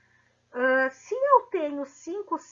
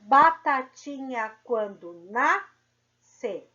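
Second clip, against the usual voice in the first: slow, louder, syllables drawn out; slow syllable count; 5 dB louder, much slower than usual. Shown as a demonstration. Teacher counting syllables of a children's rhyme, slow. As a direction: tap out each syllable. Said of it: "Então, vamos lá".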